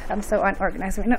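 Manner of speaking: ordinary talk, nothing marked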